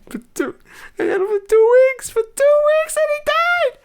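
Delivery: hammed-up sob over a pet dead in two weeks; mock crying. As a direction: ham up a breaking wail over the gerbil. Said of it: "sometimes I get a little emotional".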